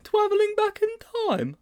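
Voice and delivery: a puzzled schoolboy asking his teacher; high, wide-open boy's question; Sponge's voice. A narrator's reading. Sponge astonished at confession.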